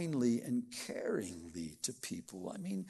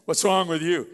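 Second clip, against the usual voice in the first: loud, harsh what's wrong with you; irritated snap; 9 dB louder than usual. Shown as a demonstration. Spouse coming home to a bad mood, snapping at them. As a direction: bark it out annoyed. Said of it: "it's the way you say it".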